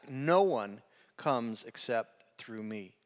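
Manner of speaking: slow, weighted, every word leaned on; singularly emphatic. Shown as a demonstration. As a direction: drive home that NO one comes, slowly. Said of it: "Singularly emphatic".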